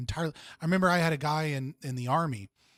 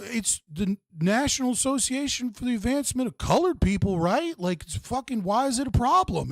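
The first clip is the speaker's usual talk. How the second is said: clueless Alaska guy; 9 semitones higher than usual. Retold or imitated. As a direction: ham up a naive guy who doesn't get the problem. Alaska guy arguing about NAACP.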